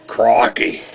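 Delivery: one-word mock Australian exclamation; Australian accent. Shown as a demonstration. Showing his entire Australian vocabulary, Australian accent. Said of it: "I'm going to do my Australian accent".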